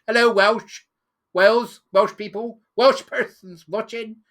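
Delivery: loud, slow call to the Welsh; loud slow address to the viewers; calling out loudly. Real, not acted. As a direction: hail the Welsh viewers across the distance.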